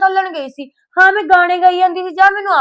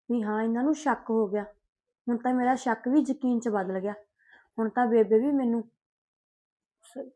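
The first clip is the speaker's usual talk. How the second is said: worried aside; 6 semitones lower, 11 dB quieter than usual. Acted. Worried aside to herself alone.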